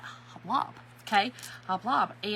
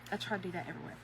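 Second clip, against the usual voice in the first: softer echo of the line; quiet; 5 semitones lower, 13 dB quieter, much faster than usual. Real, not acted. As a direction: repeat it quietly to yourself. Repeated line, much quieter.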